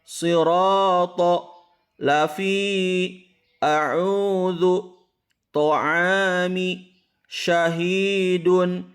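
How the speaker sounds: slow, high chanted reading of Arabic words; a teacher chanting a tajwid example list; slow Quran recitation. Shown as a demonstration. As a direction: recite each word with long vowels drawn out. Recitation continues.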